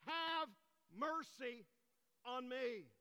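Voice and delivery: loud, high, drawn-out plea; acted crying out. Blind man begging Jesus for mercy, acted crying out word by word. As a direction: cry it out word by word, with pauses. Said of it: "he cries and screams out".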